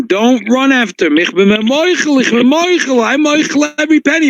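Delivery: high, pleading cry to let the thief go; pleading scream. Retold or imitated. Rabbi screaming at the crowd not to chase the thief. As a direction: call out high and urgent, begging them to stop. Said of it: "started screaming".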